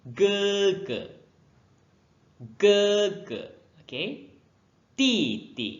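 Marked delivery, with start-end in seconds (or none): 0.1-3.4 "Ge" high and loud, second "ge" short and toneless; neutral tone demo. Shown as a demonstration. Teacher drilling "Ge, ge" for the class. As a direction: hit "Ge" high, clip the echo. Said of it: "saya pendekkan sebutan".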